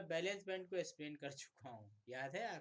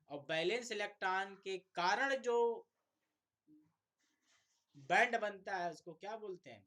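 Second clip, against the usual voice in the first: higher and louder, pressing for the answer; loud quiz question; 6 semitones higher, 10 dB louder than usual. Real, not acted. Teacher to students, demanding the answer loudly. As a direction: call the question out to the whole class.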